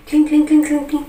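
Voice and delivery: high, loud little clinking sounds; high tinkling noise. Acted. Mimicking her dangling short legs, high tinkling noise.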